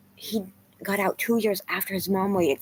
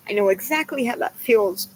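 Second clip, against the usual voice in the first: tearful; 4 semitones higher than usual. Real, not acted. Crying while talking about loss.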